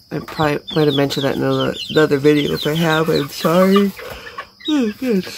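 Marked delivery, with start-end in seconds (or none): 4.7-5.3 said through a yawn. Real, not acted. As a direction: stretch it out mid-yawn. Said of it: "sorry about the yawn".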